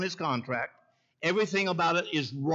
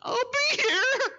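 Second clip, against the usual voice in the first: slow, high, sobbing falsetto; imitating crying woman; 3 dB louder, much slower than usual. Mayor imitating the weeping woman promising to return, slow high sob. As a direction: draw it out high through mock tears. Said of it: "And you think I'm sounding funny?".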